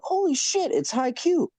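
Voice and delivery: high-pitched mock astonishment; retold amazed recognition. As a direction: suddenly realize who it is, voice leaping up high. Retold moment of recognizing him.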